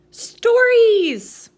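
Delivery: high, loud eager answer; excited shout. Acted. Puppet answers the librarian's question, a high excited shout. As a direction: blurt it out with glee. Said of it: "What does cuentos mean, Felix?".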